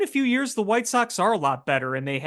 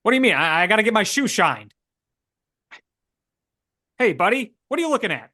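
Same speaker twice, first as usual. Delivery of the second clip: put-on higher, pushy old-movie Transatlantic tough-guy accent; 1950s Transatlantic accent; 6 semitones higher, 5 dB louder, faster than usual. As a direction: play a 1950s Transatlantic-accent spy who insists he isn't one, fast and defensive.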